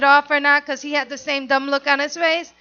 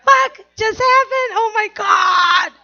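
much higher, loud, slow shocked exclaim; stunned; 6 semitones higher, 4 dB louder, slower than usual. Retold or imitated. Alone after closing the door, shocked loud exclaim. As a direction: gasp it out in disbelief.

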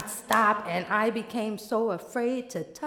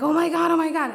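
high, loud, laughing panic; hammed-up hysterical outburst; frantic rant; 5 semitones higher, 5 dB louder, faster than usual.

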